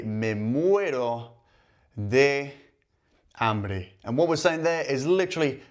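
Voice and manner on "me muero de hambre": stretched out with long pauses between words; slow demo phrase. Shown as a demonstration. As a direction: model the idiom slowly for learners. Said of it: "would be to say".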